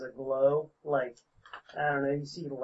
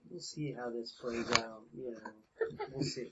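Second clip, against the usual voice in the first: low, very soft aside in character; whisper; 6 semitones lower, 13 dB quieter than usual. Acted. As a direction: murmur it under your breath. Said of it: "I sorta whisper".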